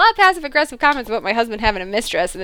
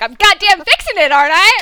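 husband snaps back, louder at the end; a huffy, louder retort from the husband; irritated husband; 5 semitones higher, 5 dB louder than usual. Retold or imitated. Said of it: "And he's like".

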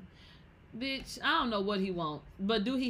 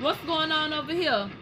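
high, loud and drawn out; loud calling out; 7 semitones higher, 5 dB louder, slower than usual. Real, not acted. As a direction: call across the room. Calling out to a viewer.

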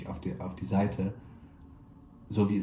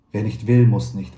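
a touch of amused teasing, louder; mildly amused, tossed off with a grin; amused reply; 11 dB louder than usual. A narrator's reading. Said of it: "antwortete ich amüsiert". (amüsiert und lauter)

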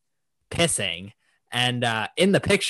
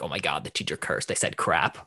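low, softened imitation of scandalized pupils; mock hushed shock; 8 semitones lower, 5 dB quieter than usual. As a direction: lightly act out a kid's awed murmur. Imitating scandalized pupils hearing teacher curse.

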